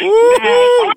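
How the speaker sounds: high, loud whoop; cheering. Real, not acted. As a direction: whoop for the team. Cheering Michigan State.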